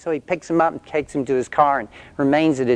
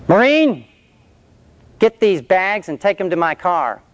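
drill sergeant bark; 5 semitones higher than usual. Retold or imitated. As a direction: order the ex-recruit around like a sergeant. Sergeant barks an order.

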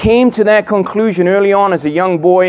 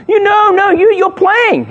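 high, louder comic panic; hammed-up desperate coach; mock frantic insistence; 3 dB louder than usual. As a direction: refuse to hear it, voice shooting up. Acted coach insisting player plays.

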